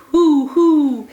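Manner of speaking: an outraged owl calling out; hollering owl. Acted. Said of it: "who hollered".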